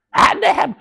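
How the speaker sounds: high-pitched repeated shout of disbelief; mock outraged shout. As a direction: hit it again, high and stunned. Repeat of the same outburst.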